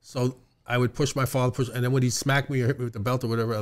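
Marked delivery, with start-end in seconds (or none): none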